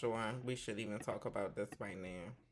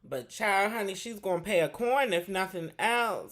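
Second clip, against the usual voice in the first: raised, louder, with a crying edge; emphatic gossip; 7 semitones higher, 6 dB louder than usual. Real, not acted. Gossip host insisting she will pay, louder and higher. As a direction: drive it home to viewers.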